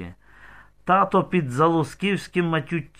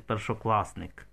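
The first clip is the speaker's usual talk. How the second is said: ordinary delivery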